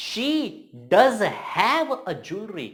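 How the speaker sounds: high "She", then very slow "does have"; emphatic stress. Shown as a demonstration. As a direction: stretch "does have" with insistence. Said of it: "कैसे बोलेंगे".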